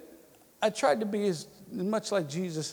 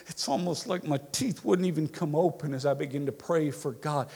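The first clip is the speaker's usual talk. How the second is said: ordinary delivery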